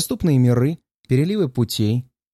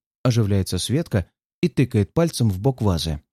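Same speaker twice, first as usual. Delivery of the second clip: ordinary delivery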